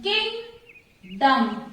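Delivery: kingdom very slow, split as king, dom; syllable by syllable. Shown as a demonstration. Teacher showing two syllables, kingdom slowly broken apart. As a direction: leave a long gap inside kingdom. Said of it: "two syllables".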